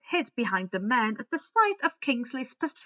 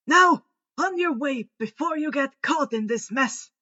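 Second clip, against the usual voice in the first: louder, brisk send-off; shooing headmaster; 3 semitones higher, 6 dB louder than usual. A narrator's reading. Headmaster shooing the students off, brisk and louder. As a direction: hurry them out the door. Dumbledore shoos them off.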